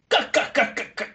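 high, dry imitated cackle; hammed-up old-witch laugh; mock cackle. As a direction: cackle in separate, staccato bursts.

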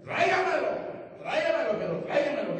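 high, loud call that eases off; urgent demand. Acted. Preacher telling the father to bring his son, urgent demand. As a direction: call out for him eagerly, insistently.

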